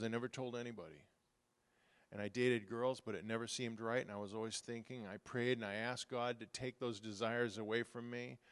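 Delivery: quiet, low retelling of a secret; hushed confession. Retold or imitated. Pastor retells a young man's confession, hushed. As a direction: say it softly like a confession.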